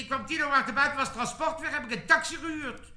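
high-pitched, animated protest; insistent excuse. Acted. Moos tells his different excuse.

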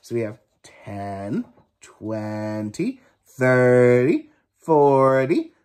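plain reading voice

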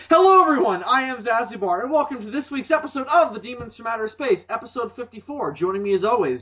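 high, upbeat podcast host opener; show intro. Acted. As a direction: announce the show with lifted, lively pitch.

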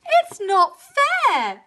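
high, drawn-out whiny bawl; loud high childish complaint; bawling cow. A narrator's reading. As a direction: wail it slowly like a sulky cow. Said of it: "bawled Cow".

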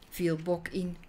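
plain reading voice

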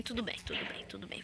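calm yourself down in a hush; fading whisper. Real, not acted. Gamer hushing to a whisper while sneaking away.

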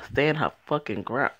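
ordinary delivery

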